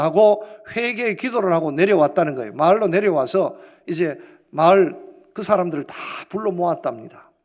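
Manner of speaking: normal talking voice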